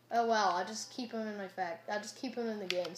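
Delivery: ordinary talk, nothing marked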